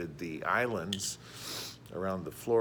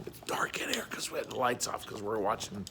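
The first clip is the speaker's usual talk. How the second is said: whisper. Real, not acted. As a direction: hush it, lights are off. Dark classroom, lights off.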